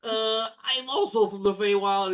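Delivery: barge in as the cooler guy who steals the scene; Blue Blairy. Acted. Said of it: "an even cooler guy ... is like".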